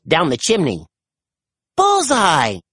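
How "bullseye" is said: high, triumphant cry; excited exclamation of success; exclaiming. A narrator's reading. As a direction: cry out a perfect hit, pitch falling away. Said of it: "she exclaimed".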